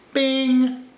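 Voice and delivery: high, loud sung "bing"; a quick mock ding for the camera; playful chime noise. Voicing a chime while adjusting.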